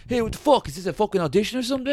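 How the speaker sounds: high, fast mock tough-guy rant; mock angry outburst. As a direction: blow up at the casting people. Boxer rages at casting.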